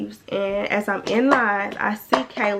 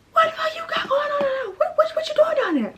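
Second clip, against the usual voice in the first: much higher, strained imitation of an angry father; dad calling his daughter, demanding, mock-angry. Dad on phone, furious.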